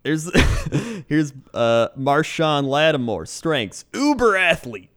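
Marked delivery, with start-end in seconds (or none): 3.9-4.9 draft analyst read. Acted. Host reading a draft profile to his cohost, analyst voice. Reads scouting report aloud.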